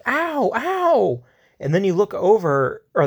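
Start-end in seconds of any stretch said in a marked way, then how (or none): 0.1-1.2 high pained yelp, pitched way up; kid yelling in pain. Retold or imitated. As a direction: cry out at a sudden stab of pain. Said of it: "you hear the kid yelling".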